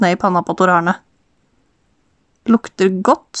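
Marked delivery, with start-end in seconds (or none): none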